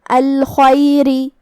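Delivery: الخیری with a thin light ر, drawn out slowly. Shown as a demonstration. Teacher models a light ر in الخیری for students. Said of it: "ہلکی آواز میں پڑھیں گے".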